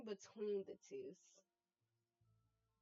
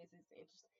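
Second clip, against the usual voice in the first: much softer, murmured repeat; quiet repeat; 14 dB quieter, much faster than usual. Shown as a demonstration. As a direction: say it again almost to yourself. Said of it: "Okay, this is interesting".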